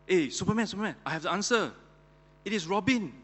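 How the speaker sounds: high, excited triumphant announcement; acted triumph, pitched well up. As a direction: announce the answer like a proud winner. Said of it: "says triumphantly".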